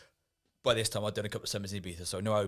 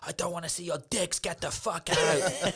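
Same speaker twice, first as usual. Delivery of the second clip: raised angry manager voice; 9 semitones higher, 4 dB louder than usual. Retold or imitated. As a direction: bark him out of the bar. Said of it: "he was like".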